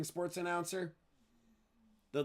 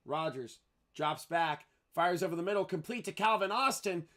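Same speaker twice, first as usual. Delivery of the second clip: football call climbing higher on the catch; play-by-play; 4 semitones higher than usual. Real, not acted. Announcer calls a first-down catch, rising play-by-play. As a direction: start measured, lift through the completion, then settle.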